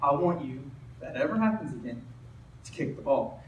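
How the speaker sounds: low, soft, patient coaching; quiet, calm instruction. Retold or imitated. Coach to his afraid kicker after the mistake, quiet instruction. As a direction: take the player aside and speak softly.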